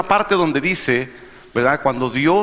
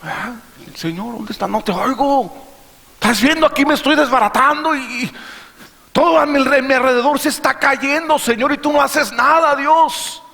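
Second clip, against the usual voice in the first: panicked disciples; 6 semitones higher than usual. Acted. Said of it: "Así estaban los discípulos".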